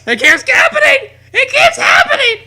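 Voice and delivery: high wailing shout; distressed shout. Acted. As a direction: wail it as if in despair. Mock distressed shouting cry.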